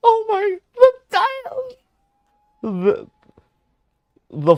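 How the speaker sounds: very high, loud gasping outburst; shocked squeal. Real, not acted. Streamer shocked by the dialogue, high loud squeal. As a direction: squeal in loud disbelief, breaking off.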